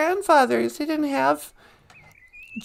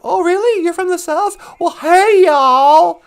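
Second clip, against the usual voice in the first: Southern impression; 3 semitones higher, 8 dB louder than usual. People hearing someone is from the South, mock Southern impression. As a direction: parody someone gushing in a fake Southern twang. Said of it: "an impression, an impersonation".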